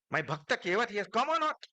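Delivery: eager calling out. Acted. Scene: Lord at the coronation calling out for Kevat, eager and high. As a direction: call out, longing to see him. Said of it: "asking also Hanumanji".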